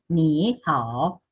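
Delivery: "Ni hao" with both syllables dipping in full; two full third tones. Shown as a demonstration. Teacher shows students the wrong reading, full third tones. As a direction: dip fully on "Ni" and on "hao". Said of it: "Pero no voy a decir".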